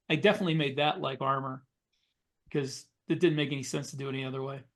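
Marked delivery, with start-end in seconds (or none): none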